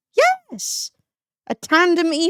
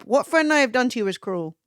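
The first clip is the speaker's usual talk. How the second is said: ordinary talk, nothing marked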